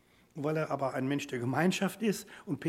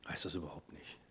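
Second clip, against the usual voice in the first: whisper; 9 semitones lower, 12 dB quieter than usual. Real, not acted. Preacher drops to a hush.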